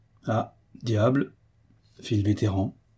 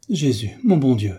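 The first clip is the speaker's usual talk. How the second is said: aghast, more frightened than angry; alarmed exclamation; 2 semitones higher, 4 dB louder than usual. A narrator's reading. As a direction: exclaim in mild dread. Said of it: "s'écria la ménagère avec plus d'effroi".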